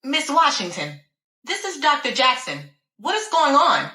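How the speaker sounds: a hint of a frightened doctor asking; concerned doctor. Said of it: "she sounded very concerned and frightened".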